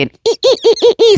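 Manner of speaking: high squeaky ee ee ee chimp calls; chimp noises. Shown as a demonstration. Teacher trying out a gorilla sound for kids, chimp squeaks. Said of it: "sounds more like a chimpanzee".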